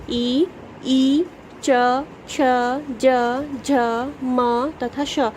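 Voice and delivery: slow, separate palatal sounds; drawn-out last letters, each sound apart; letters said clearly. Shown as a demonstration. Teacher to children, demonstrating palate letters slowly and clearly. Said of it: "आप एक बार बोल के देखें".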